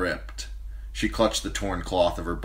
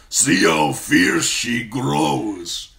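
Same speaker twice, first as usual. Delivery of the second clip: very slow, loud, higher warlord boast; proud khal; 7 semitones higher, 9 dB louder, much slower than usual. Acted. Khal praises his fierce wife, slow loud boast. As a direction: savour her fierceness aloud. Drogo delighted.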